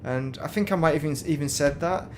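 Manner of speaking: usual speaking voice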